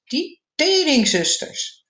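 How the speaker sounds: high, drawn-out indignant cry at normal volume; mock yell, stretching the curse out high; retold angry outburst. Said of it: "schreeuwde ik opeens heel hard".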